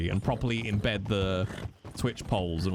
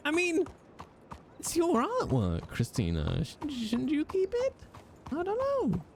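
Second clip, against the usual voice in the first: a much higher put-on voice, hesitant; high silly voice. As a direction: play a coy, falsetto character.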